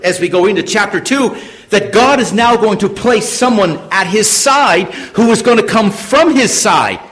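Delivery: high, loud sermon delivery; impassioned preaching. Real, not acted. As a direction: drive the point home to the congregation. Preaches at raised force.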